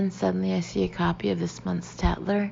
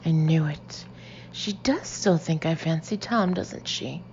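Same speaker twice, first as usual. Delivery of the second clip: usual speaking voice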